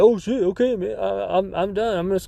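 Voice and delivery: lightly voiced sheepish old man; backing-down old guy. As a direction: play him hastily giving up. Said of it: "he's like".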